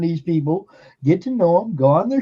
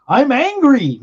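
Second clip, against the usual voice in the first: mock angry voice, pitched up; mock angry reading; 8 semitones higher, 7 dB louder, slower than usual. To chat, how his texts come across, in a mock angry voice. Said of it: "No, I'm not".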